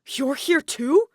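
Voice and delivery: high, loud, slow astonishment; can't believe he's here; shocked outburst; stunned disbelief. A narrator's reading. Kaito reacting in shock.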